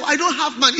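high, loud mock refrain; like a familiar song. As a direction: parrot it like an old refrain. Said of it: "it's like a song".

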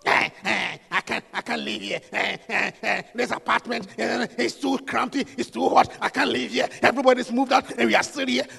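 low, soft mock whine of a complaining wife; whiny wife. Preacher mocks a wife complaining about the apartment, in a low whiny voice. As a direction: mimic a wife grumbling that she can't live here.

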